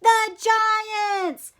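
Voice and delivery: high team shout. Acted. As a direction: cheer it loud. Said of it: "shouted the team".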